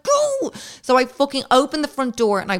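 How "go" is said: very high urgent squeal; shrieked command; squeaky go. Retold or imitated. To the French man at the door, a high shriek.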